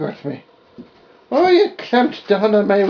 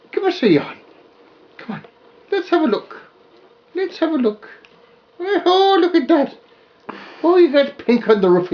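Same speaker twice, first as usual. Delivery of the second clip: high, singsong puppy talk; high baby talk; 10 semitones higher than usual. Acted.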